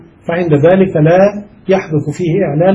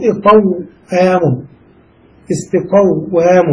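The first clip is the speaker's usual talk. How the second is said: slow demonstration; much slower than usual. Shown as a demonstration. Teacher to grammar students showing the original forms, slow and deliberate. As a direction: spell out إقوام and استقوام slowly, syllable by syllable. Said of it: "ننقل الفتحة إلى القاف".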